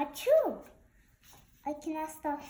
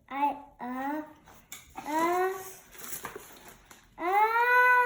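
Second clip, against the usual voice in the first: sneeze building; 2 semitones higher, 7 dB louder, much slower than usual. Acted. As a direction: wind up a coming sneeze.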